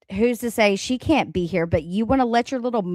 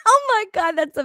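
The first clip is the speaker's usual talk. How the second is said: laughing surprise; 9 semitones higher, 4 dB louder, faster than usual. Real, not acted. Reacting to a chat pun.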